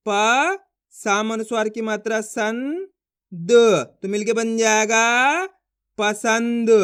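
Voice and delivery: slow letter by letter, held प, rising सन, then joined पसंद; spelling out. Shown as a demonstration. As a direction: stretch each sound, pause, then blend into पसंद. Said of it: "देखो एक बार फिर से".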